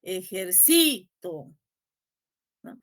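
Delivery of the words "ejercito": "Ejercito" slow, with CI drawn out; stress on CI. Shown as a demonstration. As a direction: lean on the third syllable: e-jer-CI-to. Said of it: "pronuncien las palabras con distintas". ("Ejercito" despacio, alargando la sílaba CI)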